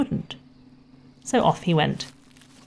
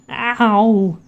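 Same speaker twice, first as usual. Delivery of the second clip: sudden loud yelped ow; 5 semitones higher, 8 dB louder than usual. A narrator's reading. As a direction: cry out as the jar bumps. Pooh bumping jar.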